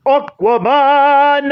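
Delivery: high, slow, drawn-out title; underwater voice. Pretend. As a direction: announce it like underwater royalty. Dramatic title announcement.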